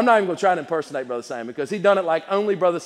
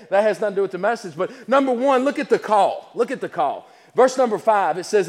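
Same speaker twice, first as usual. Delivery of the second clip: ordinary delivery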